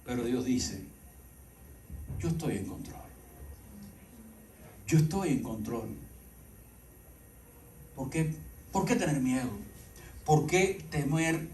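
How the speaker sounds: low, soft, measured appeal with long pauses; quiet, deliberate reassurance to the congregation; hushed preaching. Acted. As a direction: bring the sermon down to an intimate hush. Slow, hushed sermon appeal.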